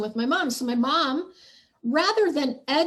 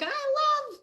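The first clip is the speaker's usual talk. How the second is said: put-on childish glee; 14 semitones higher than usual. Child gushing over a new word.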